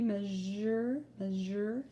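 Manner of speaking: "measure" with a clear zh, rising; zh sound in measure. Shown as a demonstration. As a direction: stretch the zh in "measure". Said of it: "It makes that Z".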